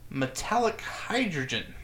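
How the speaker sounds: ordinary talk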